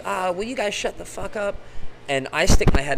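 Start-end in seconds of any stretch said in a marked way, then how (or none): 0.0-1.5 high, mock yell from below, in a different voice; angry stranger. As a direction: imitate the angry neighbour shouting up. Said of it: "someone down below goes".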